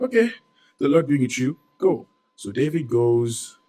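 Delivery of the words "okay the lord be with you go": higher, mock-solemn send-off from the king; a hammed-up king blessing him on his way; king's blessing. Saul sends David off.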